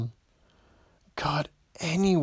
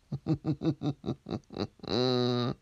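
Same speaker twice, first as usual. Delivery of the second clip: sinister chuckle; 3 dB quieter than usual. Acted. Villain laugh.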